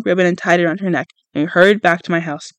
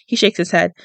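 ordinary delivery

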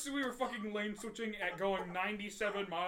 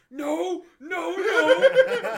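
higher, drawn-out outraged denial; retold indignant yell, half laughing; loud mock protest; 9 semitones higher, 15 dB louder, much slower than usual. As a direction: protest loudly through laughter.

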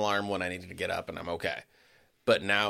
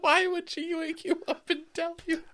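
laughing. Real, not acted.

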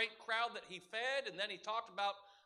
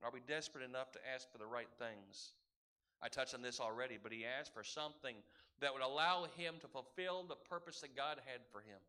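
sudden quiet; 9 semitones lower, 8 dB quieter than usual. Real, not acted. Preacher drops to a near whisper.

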